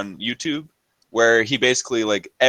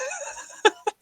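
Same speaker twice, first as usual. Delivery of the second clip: high laugh; 6 dB quieter than usual. Real, not acted. Laugh mid story.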